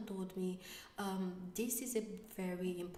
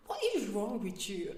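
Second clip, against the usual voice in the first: loud, playful scold; mock scolding; 2 semitones higher, 7 dB louder than usual. To her husband after his fake sad face, a mock scolding. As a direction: tell him off, half laughing.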